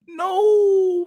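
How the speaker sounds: Jordan Peterson impression. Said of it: "I gotta get the right voice for that one".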